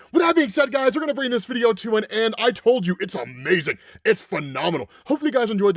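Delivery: loud, high, rushed burst of hype; pumped-up YouTuber wrap-up; excited outro. Real, not acted. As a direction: blast the sign-off like you can't contain it.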